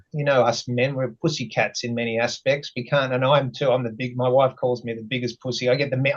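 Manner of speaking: normal talking voice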